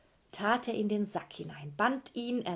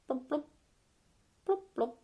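bubbling blubs rising higher; sinking bubbles; 7 semitones higher than usual. Acted. Giant mother sinks in the river, blubbing ever higher. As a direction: blub like someone sinking under water.

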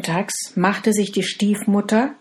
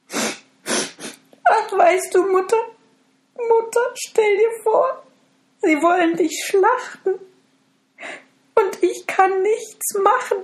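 put-on much higher, anxious young girl; distressed girl; 3 dB louder than usual. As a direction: despair to the cow.

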